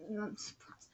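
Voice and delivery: whispered I'm surprised; hushed sarcastic whisper; whispered aside. Real, not acted.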